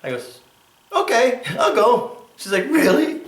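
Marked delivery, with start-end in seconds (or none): 0.9-2.2 eager. Retold or imitated. Quoting himself to wife. 2.7-3.2 surprised. Retold or imitated. Wife's surprised question.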